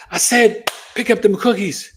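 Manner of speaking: high, loud sudden mother's yell; mom snapping. Retold or imitated. Mother suddenly yelling at her kid over cookies, loud and high. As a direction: flip from sweet to furious mom. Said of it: "And then you'd be like".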